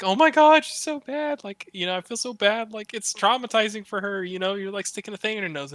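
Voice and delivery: high, fretful mock-anxious voice; worried fiancee. Dad imitating his fiancee fretting over the baby, high and anxious. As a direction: fuss like an overly concerned mom. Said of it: "so concerned and is just like".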